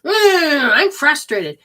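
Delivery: mock frustrated groan from the body; voicing the frustrated body. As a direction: let the body complain out loud. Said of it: "The body says".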